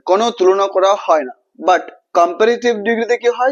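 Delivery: normal talking voice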